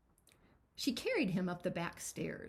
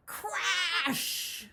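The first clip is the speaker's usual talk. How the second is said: crash sound; 10 semitones higher, 5 dB louder than usual. Acted. Stairs break under them.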